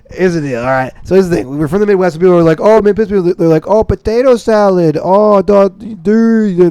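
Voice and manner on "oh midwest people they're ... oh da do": higher, dopey mock Midwestern accent. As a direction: parody how media does the Midwestern accent.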